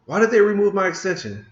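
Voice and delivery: fed-up coder venting at the screen; exasperated outburst. Real, not acted. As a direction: blurt out the complaint in disbelief. Frustrated at removed extension.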